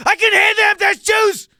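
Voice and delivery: high-pitched screaming rant; full-volume, very high ranting impression; Brian Holtzman. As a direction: shriek it at the top of your voice, outraged.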